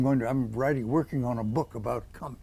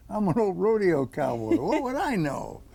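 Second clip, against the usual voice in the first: higher-pitched mock disbelief; baffled protest; 6 semitones higher, 3 dB louder than usual. Old rodeo cowboy invited to speak, baffled protest. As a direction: act out a self-deprecating protest, pitch climbing.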